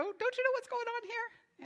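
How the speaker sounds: high-pitched frantic waking cry; panicked disciples. Acted. Frightened disciples waking Jesus in the storm, high and panicked.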